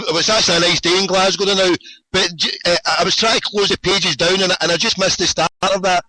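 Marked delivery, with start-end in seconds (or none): none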